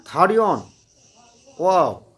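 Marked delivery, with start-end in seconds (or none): none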